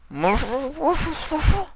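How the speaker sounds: high, muffled through pressed lips; through squished lips. A narrator's reading. Nightmare Moon, cheeks squished by Sombra, muffled and high. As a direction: talk with cheeks squeezed.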